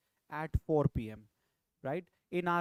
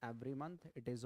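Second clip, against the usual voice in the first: every month dropped much softer; quiet aside; 5 semitones lower, 9 dB quieter, faster than usual. Shown as a demonstration. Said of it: "If you just say".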